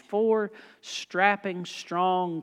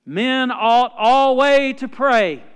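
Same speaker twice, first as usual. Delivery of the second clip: higher, much louder and very slow; preaching proclamation; 5 semitones higher, 10 dB louder, much slower than usual. Real, not acted. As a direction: ring it out over the congregation.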